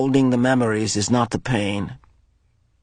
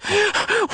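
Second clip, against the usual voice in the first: sharp gasp. Acted. Sob before question.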